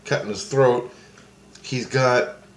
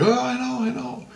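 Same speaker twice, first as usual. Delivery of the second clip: high voice of Christ. Retold or imitated. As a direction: voice him high and gentle. Christ voiced in dream story.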